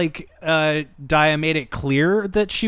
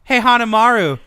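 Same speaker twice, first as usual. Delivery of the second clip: raised, louder mock threat; super menacing; 6 semitones higher, 5 dB louder than usual. Character confronting Hanamaru about udon, mock menacing. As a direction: imitate someone picking a fight, hammed up. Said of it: "super menacing".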